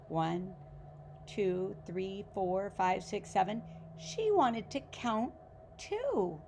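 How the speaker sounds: put-on reading a picture book aloud to children; story narration. A narrator's reading. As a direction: tell it like a bedtime story for kids.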